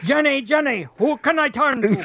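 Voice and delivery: Scottish accent. Shown as a demonstration. Host trying song lyrics in Scottish, comic Scottish accent. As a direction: give the lyrics a playful Scottish lilt. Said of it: "do it with a Scottish accent".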